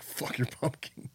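put-on whispered, slow drunken sneer; mock drunk roommate. Drunk roommate brushing off the pumpkin, slow whispered sneer. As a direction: breathe it out like a sullen drunk. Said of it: "And he's like".

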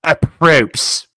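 insistent repeated approps. Pretend.

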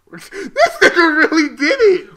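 shouting through laughter; high laughing outburst. Real, not acted. Disbelief at friend's move.

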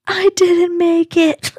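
high, loud squeal through stifled laughter; loud, squeaky confession of defeat; mock cracking up. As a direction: imitate someone helpless with laughter, pitch way up. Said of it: "he just busts out laughing".

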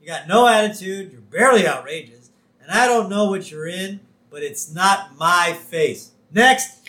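loud, scornful rejection; dismissive. Retold or imitated. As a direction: dismiss him flatly. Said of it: "Myers changes his tune".

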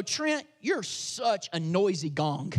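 drawn-out mock insult to a friend; mock dig. Shown as a demonstration. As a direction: tease him with a slow, low jab. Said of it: "if I wanted to take a dig at you".

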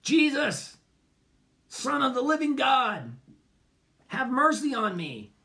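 high, slow pleading cry; high slow heartfelt plea; earnest prayer. Shown as a demonstration. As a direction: lift the prayer up slowly, pleading. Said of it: "that's your good first prayer".

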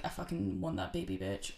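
low, soft evil doll voice; creepy doll voice. Retold or imitated. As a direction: growl it low like a possessed doll. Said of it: "the doll is like".

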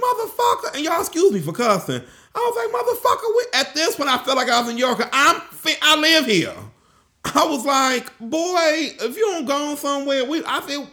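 high-pitched, exasperated comeback; indignant retort. Retold or imitated. Guest retorts to hotel security guard, indignant.

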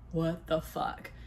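low, quiet, slow mumble of dismay; groggy disbelief. Retold or imitated. Woman waking after the collapse, low groggy disbelief. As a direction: drag it out softly, barely awake.

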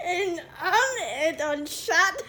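loud, high, slow through laughter; shrieking with laughter; helpless laughter. Real, not acted. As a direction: force the words out while cracking up. Mangling French while laughing.

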